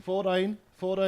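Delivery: Kiwi accent. Shown as a demonstration. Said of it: "They say fourteen or thirteen".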